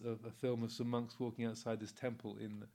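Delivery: ordinary talk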